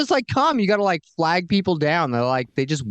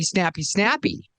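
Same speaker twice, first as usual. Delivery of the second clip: quick snappy; 5 semitones higher than usual. Shown as a demonstration. Acting out snappy city speed.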